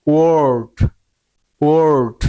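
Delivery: ওয়ার্ড with a long, round "or". Shown as a demonstration. Teacher giving an example of O plus R, rounded ওয়ার্ড. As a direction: round the lips through the vowel of ওয়ার্ড. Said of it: "মুখটা কি গুল হবে".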